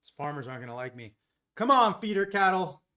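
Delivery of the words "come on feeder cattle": loud urging, a little higher; root for the trade out loud; cheering on. Real, not acted.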